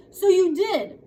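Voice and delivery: high, loud gotcha; triumphant. A narrator's reading. Sister triumphs over her brother, high and loud. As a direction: crow over catching him. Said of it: "Adele said in triumph".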